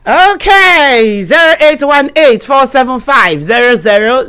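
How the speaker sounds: loud, high, projected host announcing numbers; loud radio announcer reading out digits; radio phone-in announcement. Real, not acted. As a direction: belt out the phone numbers to listeners.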